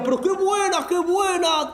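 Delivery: mock delight; delighted exclaim. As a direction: cry out that it's great. Banker laughing at the joke.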